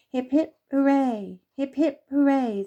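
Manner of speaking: high, loud slow cheer from a crowd of animals; a happy little crowd shouting hooray. Acted. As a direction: cheer it out together, slow and joyful. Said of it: "they all cheered".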